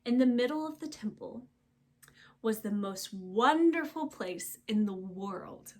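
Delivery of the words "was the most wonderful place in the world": plain reading voice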